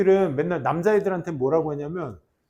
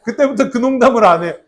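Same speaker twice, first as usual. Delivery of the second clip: words breaking up with real laughter; laughing; 4 semitones higher, 8 dB louder than usual. Real, not acted. Teacher cracking up at his own classroom story, laughing. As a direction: laugh through the punchline.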